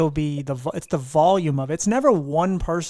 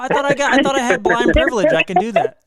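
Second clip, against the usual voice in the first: laughing joke; 9 semitones higher, 5 dB louder than usual. Real, not acted. Joking about blind privilege.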